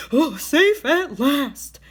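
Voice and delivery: slow, relieved little skunk voice; skunk crying out. A narrator's reading. Skunk on his island, relieved to be safe, slow cry. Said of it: "cried the skunk".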